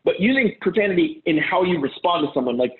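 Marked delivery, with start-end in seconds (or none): none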